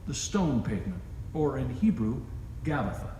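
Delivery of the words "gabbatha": ordinary talk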